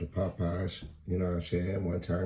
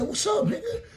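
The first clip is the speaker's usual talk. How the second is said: high mock challenge with a laugh; the mugger repeats his challenge, high and laughing; 4 dB louder than usual. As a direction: push it up high through a laugh. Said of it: "He said".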